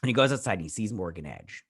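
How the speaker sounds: ordinary delivery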